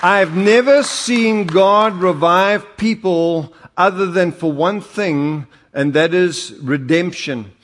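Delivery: slow, loud preaching build. Acted. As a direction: preach it with fire. Preaching at full force.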